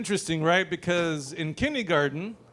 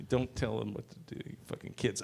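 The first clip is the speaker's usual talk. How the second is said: whispered aside; 9 semitones lower, 12 dB quieter than usual. Real, not acted. Muttered aside.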